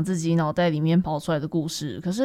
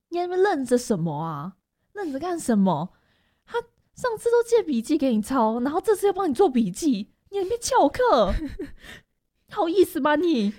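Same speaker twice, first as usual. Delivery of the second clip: rising, higher mock scolding; scolding classmate; 8 semitones higher than usual. Classmate scolds her for skipping class, mock nagging. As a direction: nag her like a bossy classmate.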